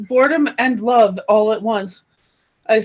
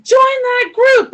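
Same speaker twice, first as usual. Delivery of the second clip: high, loud, slow command; woman's inner voice; 13 semitones higher, 8 dB louder, slower than usual. Retold or imitated. Inner woman's voice telling her to join the group, high and commanding. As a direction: order it like a voice from beyond. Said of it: "She's like".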